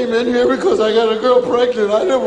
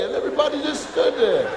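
ordinary delivery

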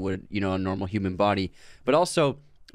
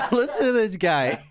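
much higher, words bubbling with laughter; laughing; 13 semitones higher than usual. Real, not acted. Host pointing out his choking co-host, laughing. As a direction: tease him while cracking up.

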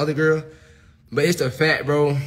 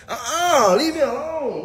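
mock whimper; 11 semitones higher, slower than usual. Mock crying refusal.

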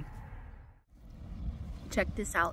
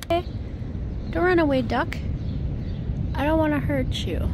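higher, gentle coaxing voice for a little animal; pitched up like talking to a pet; coaxing a duck; 6 semitones higher, faster than usual. Real, not acted. Coaxing a duck not to flee.

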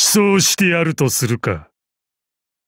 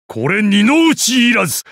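proud proclamation; 9 semitones higher than usual. Acted. Finishing move declaration.

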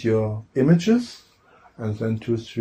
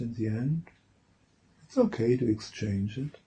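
trance introduction voice. Real, not acted. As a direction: ease listeners into trance, leaving space between phrases. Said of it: "change my voice into my trance introduction voice".

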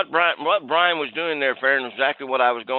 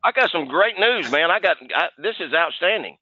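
higher, pumped-up sales pitch; an overexcited mechanic bursting with good news; over the top; 4 semitones higher than usual. Shown as a demonstration. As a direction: gush like the best news ever. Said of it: "just kinda go over the top".

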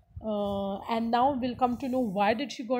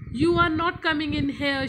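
raised, louder fierce refusal; 4 semitones higher, 6 dB louder than usual. Acted. Cook refusing the boys at the gate, fierce. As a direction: bar the door fiercely. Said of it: "she said fiercely".

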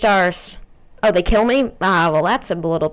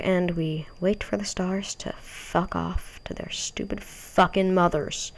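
slow, soft unvoiced grumble; whispered curse; 8 dB quieter, slower than usual. Real, not acted. Gamer muttering at the stars to go away. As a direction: hiss it under your breath at the screen.

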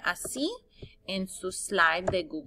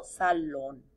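"salón" low, marking the accented final O; stress on the O; 5 semitones lower than usual. Shown as a demonstration. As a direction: model "salón" with the weight on the O. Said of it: "Acento en la O". (acento en la O de "salón")

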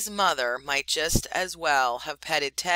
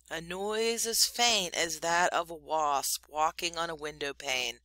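usual speaking voice

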